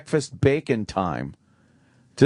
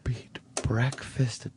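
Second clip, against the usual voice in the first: whisper; 8 dB quieter, much slower than usual. Real, not acted. Guessing the bit, hushed.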